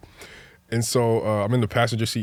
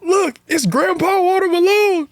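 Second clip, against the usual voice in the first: put-on high, loud drawn-out kid's holler; imitated yell; 6 dB louder than usual. Kid yelling at someone recognized across the street, imitated high holler. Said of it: "one of them yells out".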